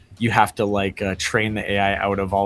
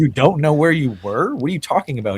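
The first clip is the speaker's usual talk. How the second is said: higher, louder mock disbelief; baffled friend; 7 semitones higher, 5 dB louder than usual. Friend baffled by AI-as-person's lies, mock disbelief. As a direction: question him in disbelief. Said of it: "And he's like".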